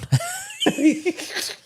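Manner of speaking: a burst of high-pitched laughter; real high laugh at the memory; high squealing laugh. Real, not acted. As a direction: squeal with delight at the story. Laughing at driver fetching package.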